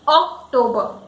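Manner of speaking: OCTOBER as ok-TOE-buh; English pronunciation. Shown as a demonstration. Teacher giving the English pronunciation. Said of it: "But in English, we say it".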